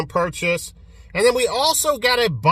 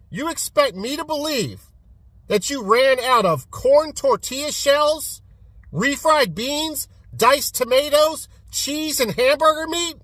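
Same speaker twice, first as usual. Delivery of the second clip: incredulous disbelief; 7 semitones higher than usual. Real, not acted. Mock disbelief at Taco Bell.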